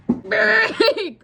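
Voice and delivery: high, loud straining yell; effort shout. Real, not acted. Strains on the bolt.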